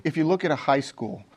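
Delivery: ordinary talk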